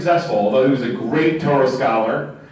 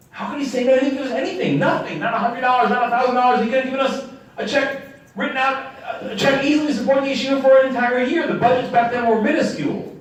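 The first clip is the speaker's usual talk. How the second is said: incensed student; 7 semitones higher than usual. Retold or imitated. Student's outrage voiced.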